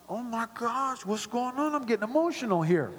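high-pitched, welling-up voice; mock choked up. Viewer reacting to the closing ceremony, comically emotional. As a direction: play at being surprised by your own tears. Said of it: "it got me all choked up".

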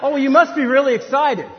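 loud, high, mock enthusiasm; gushing excitement. Well-wisher to the expecting father, hammed-up excitement. The response of people.

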